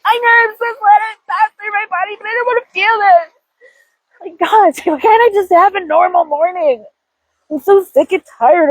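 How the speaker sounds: high, fast whiny complaint; whiny inner self. Retold or imitated. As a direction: whine like a tired kid who won't listen.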